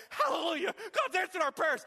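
high, loud cry of thanks; elated high call of hallelujah; joyful relief. Acted. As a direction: shout it up high like parched people finding water. Hebrews find water, rejoice.

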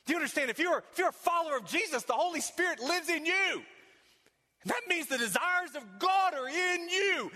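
preacher building to a climax; building preaching. Acted. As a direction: preach it with rising fire.

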